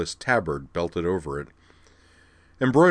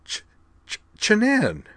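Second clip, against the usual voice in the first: high, excited stammer on a name; stammered cry; slower than usual. A narrator's reading. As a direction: cry the name out, stumbling on its first sound. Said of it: "and cried".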